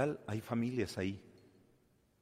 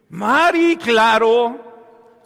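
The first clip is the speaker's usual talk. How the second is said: ironic exclamation; 11 semitones higher, 14 dB louder, much slower than usual. Real, not acted. Preacher answers his own question ironically.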